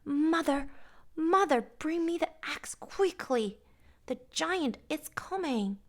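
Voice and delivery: high, loud, urgent shout; Jack shouting. A narrator's reading. Jack sliding down, shouting to his mother for the axe. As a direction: yell for help down the hill.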